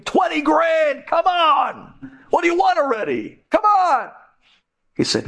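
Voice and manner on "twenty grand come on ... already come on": desperate begging. Retold or imitated. Men begging for forgiveness.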